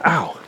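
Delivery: sharp ow of pain; pained yelp. Real, not acted. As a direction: yelp as the toe hits rock. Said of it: "Stubbed my toe again".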